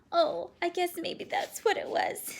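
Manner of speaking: put-on high girl's voice laughing through tears; teary relieved laugh. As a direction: laugh with relief, still half crying.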